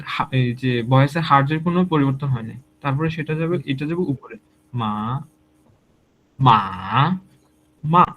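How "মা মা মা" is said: মা through level, rising, dipping; tone demo. Shown as a demonstration. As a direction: contrast the tones on মা. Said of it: "আমি আরেকবার বলতেছি".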